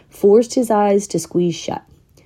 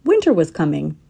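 usual speaking voice

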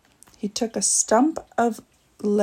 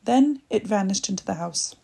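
ordinary delivery

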